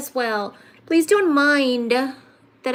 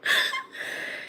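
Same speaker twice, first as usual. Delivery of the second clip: high laugh; 7 dB quieter than usual. Real, not acted. Laughs after naming.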